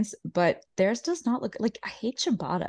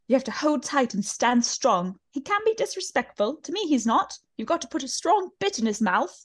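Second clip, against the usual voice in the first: higher, lightly posh British put-on; British accent, lightly done; imitating Lisa's accent; 7 semitones higher than usual. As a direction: play the grand dame with a plummy lilt. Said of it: "And she's like".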